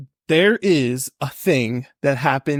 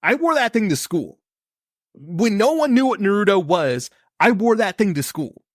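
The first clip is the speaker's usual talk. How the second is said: higher-pitched indignant insistence, punching key words; fired up; 4 semitones higher than usual. Real, not acted. Fan proving he wore the headband to school, fired up. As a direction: defend your fandom with heated, comic indignation. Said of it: "He's fired up".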